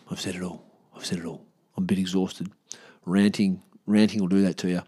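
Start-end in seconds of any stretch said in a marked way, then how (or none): 0.1-0.6 soft, spent and trailing off; worn-out mumble. Real, not acted. Wrapping up the rant, exhausted and hushed. 1.0-1.4 soft, spent and trailing off; worn-out mumble. Real, not acted. Wrapping up the rant, exhausted and hushed.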